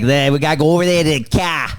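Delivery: quick, exaggerated Boston accent; huge Boston accent. Mocking the film character's speech, huge Boston accent. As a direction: drop the r's like a Boston cop. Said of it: "Huge Boston accent".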